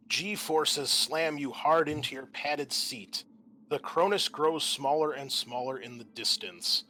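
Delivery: usual speaking voice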